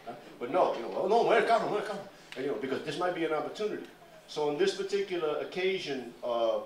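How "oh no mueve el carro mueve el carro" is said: high, loud, overeager agreeing voice; loud eager "no problem" in Spanish; eager people-pleaser. Retold or imitated. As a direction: play the local who hurries to comply. Eager locals obeying the crew.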